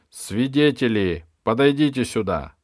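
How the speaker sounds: ringing courtroom command; calling witnesses. Acted. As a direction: call them forward across the hall. Bishop summons witnesses in court.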